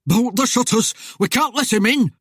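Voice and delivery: high, loud urgent cry; panicked order. A narrator's reading. Husband orders shutters bolted against Death, panicked. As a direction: raise pitch and volume in alarm.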